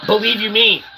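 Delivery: ordinary delivery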